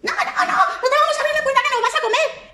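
squeaky stubborn no-no-no; defiant piglet. Acted. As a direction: piglet refusing the wolf. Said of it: "Y los cerditos, ¿qué le dijeron?".

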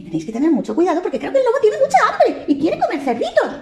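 urgent little bird warning; tiny bird sounding the alarm. Acted. Bird warns about the wolf.

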